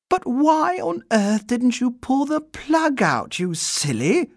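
high, slow fond scolding; young girl voice. Acted. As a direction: tease him gently. Judy scolding fondly.

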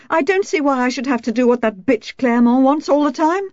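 high-pitched, shrill complaint. A narrator's reading. Wife resenting a bossy neighbour, shrill outburst. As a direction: let the voice rise shrilly in resentment. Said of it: "Her voice rose shrilly for a second".

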